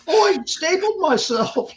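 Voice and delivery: high, whiny mocking voice; squeaky put-on complainer voice; mock whiner. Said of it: "You whine and piss around".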